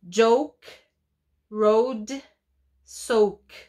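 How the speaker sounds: "Joke, road, soak" slow and clear, each word drawn out; teacher modeling words. Shown as a demonstration. Teacher models Joke road soak slowly for the class. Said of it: "teacher models rhyming words".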